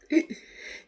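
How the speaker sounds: quick high giggle; brief, amused burst of laughter; real giggle. Real, not acted. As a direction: let a short giggle slip out. Narrator laughs after her own aside.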